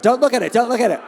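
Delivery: loud high yelp of embarrassment; panicked shout. Real, not acted. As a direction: shout it fast to stop everyone looking. Wrong slide shown, begs audience.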